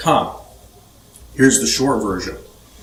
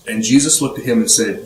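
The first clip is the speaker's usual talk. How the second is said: usual speaking voice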